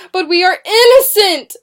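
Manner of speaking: high, loud, desperate protest; shriek. A narrator's reading. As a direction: shriek your innocence. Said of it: "Susanna shrieked".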